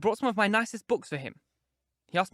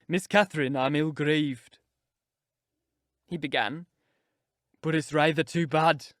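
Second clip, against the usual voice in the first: put-on rough young man. A narrator's reading. Earnshaw stops her on road.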